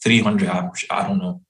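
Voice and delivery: ordinary talk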